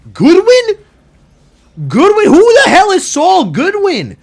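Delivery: high, loud mock outrage; incredulous outburst. Real, not acted. Gamer yelling at his own slip, high and loud. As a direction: shriek the wrong name in disbelief.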